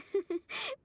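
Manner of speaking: a bright high laugh that rises; cheerful laugh. Acted. Sarah handing over the dish, cheerful little laugh. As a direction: laugh warmly while serving.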